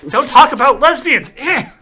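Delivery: high, loud mock outrage; mock outraged yell. Mocking the overreacting character, hammed-up outraged yell. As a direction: ham up the shocked overreaction.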